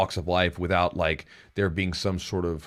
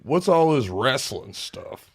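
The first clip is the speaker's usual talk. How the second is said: mock old judge; 5 semitones higher, much slower than usual. Mock judge confused by wrestling.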